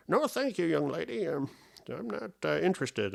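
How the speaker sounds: high quavery old man, fully put on. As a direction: turn down the girl like a creaky old gentleman. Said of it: "I get this old man voice".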